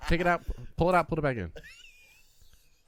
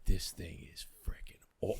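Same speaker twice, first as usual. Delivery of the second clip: whispering; 8 semitones lower, 6 dB quieter, slower than usual. Retold or imitated. Awed whisper at the scope.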